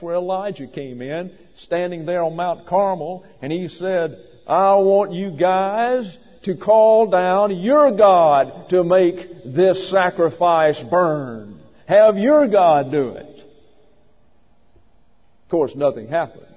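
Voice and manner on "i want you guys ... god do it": loud, slow, higher proclamation; booming challenge. Acted. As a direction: throw down the challenge, drawing out the words. Preacher acts Elijah's challenge on Carmel.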